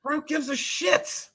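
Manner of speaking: high, slow mocking echo. Echoing his cohost, high and drawn out.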